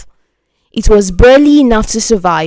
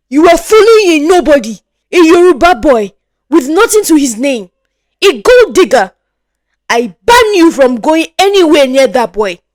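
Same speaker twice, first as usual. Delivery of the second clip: higher, louder furious tirade; furious shouting; 5 semitones higher, 5 dB louder than usual. Acted. Father to daughter, furious shout. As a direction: rage at her, faster. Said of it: "He shouts at her".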